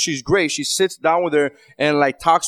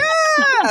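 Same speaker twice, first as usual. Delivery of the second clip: yelp. Retold or imitated. Dad squealing at his toddler to distract her. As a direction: burst out with a sudden squeal. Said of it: "And, like".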